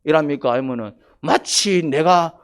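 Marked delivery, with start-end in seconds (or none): none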